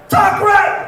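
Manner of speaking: high, loud mock mom yell; impatient shout. Mom fed up with son's stutter, shouting impatiently. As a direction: explode in impatience. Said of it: "my mom was impatient".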